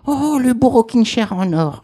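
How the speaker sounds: high, slow, marvelling; a lightly voiced old woman in awe; admiring. Cendrillon marvels at her golden chair, slow.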